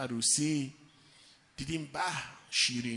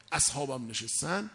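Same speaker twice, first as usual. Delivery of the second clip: usual speaking voice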